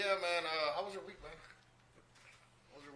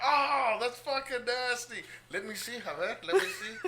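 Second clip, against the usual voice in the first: excited squeal; 7 semitones higher, slower than usual. Retold or imitated. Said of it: "we were all like".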